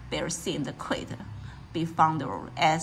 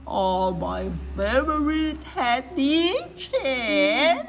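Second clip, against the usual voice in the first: slow, stretched bear voice with a cry in it; mock booming bear, drawn out; lightly voiced hungry bear; 5 semitones higher, slower than usual. A narrator's reading. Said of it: "他用很低沉的声音这么说的".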